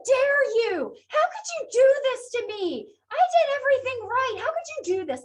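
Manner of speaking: high, loud mock venting; venting rant. Shown as a demonstration. Lecturer acting out a venting friend, loud and high. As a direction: lay into someone who wronged you. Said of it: "basically laying into somebody".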